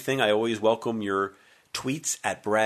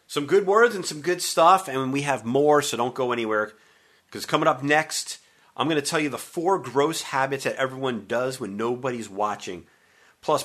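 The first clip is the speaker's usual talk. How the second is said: radio host hype; 4 semitones higher, 4 dB louder than usual. Acted.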